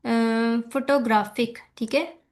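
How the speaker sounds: usual speaking voice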